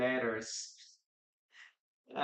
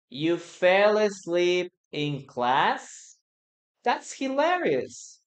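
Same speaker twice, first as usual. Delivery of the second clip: slow question, then a much higher amused punchline; dictation pace; 4 semitones higher, 3 dB louder, slower than usual. Shown as a demonstration. Teacher dictating the sentence for learners to write. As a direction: dictate slowly, then laugh it off up high. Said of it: "Listen to me and write it down".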